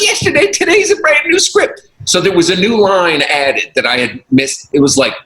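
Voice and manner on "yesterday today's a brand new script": very high, incredulous scolding voice; Cosby impression. Cosby mocking the actor for reading old script, very high. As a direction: squeak "Yesterday?" in disbelief. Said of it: "He goes".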